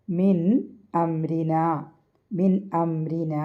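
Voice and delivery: slow, careful മിൻ അംരിന with every "n" sounded; measured recitation, clear "n" in മിൻ; clearly pronounced noon. Shown as a demonstration. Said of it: "അത് ഉച്ചരിക്കേണ്ട നൂനാണ്".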